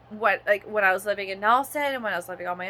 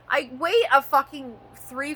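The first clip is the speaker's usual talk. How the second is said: normal talking voice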